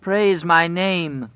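high, loud, drawn-out demand; whining. A narrator's reading. Wolf whining for praise as it draws closer, whining. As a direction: whine it slowly, loud and high. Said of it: "the wolf whined".

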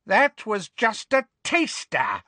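high, slow taunt; high-pitched cruel gloating; gloating villainess. A narrator's reading. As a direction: draw it out with wicked relish. Bellatrix gloats after the curse.

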